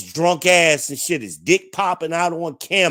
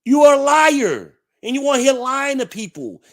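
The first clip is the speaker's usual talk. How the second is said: much higher, louder accusation; accusing shout; 11 semitones higher, 5 dB louder than usual. Real, not acted. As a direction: call her a liar to her face. Calling out accuser.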